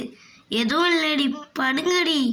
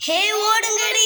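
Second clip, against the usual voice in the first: high, loud frightened call to flee; scared cry to run; 6 semitones higher, 3 dB louder than usual. Acted. Girl yelling at friends to flee the ghost, high and scared. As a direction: yell at friends to run in panic.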